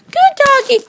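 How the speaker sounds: high squeaky praise; doggy praise. Real, not acted. Praising the dog.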